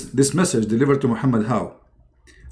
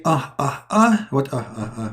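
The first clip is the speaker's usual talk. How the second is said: mock yawn. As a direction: act out a lazy yawn. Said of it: "Well, he's yawning".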